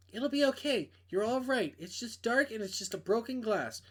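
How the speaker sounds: a hint of a young woman on the phone; girl voice. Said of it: "I can't do girl voices".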